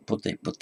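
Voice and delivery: pote first as PO-te, then as po-TE; pote, pote: first strong start, then strong ending; contrasting stress. Shown as a demonstration. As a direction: shift the stress in pote from first to last syllable. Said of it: "the difference is in stress".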